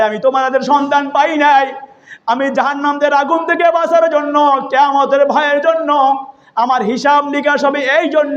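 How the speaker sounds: high chanted plea in a sermon; melodic preaching. Retold or imitated. Preacher voicing Omar to the mother, melodic preaching. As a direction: chant Omar's words high and sing-song.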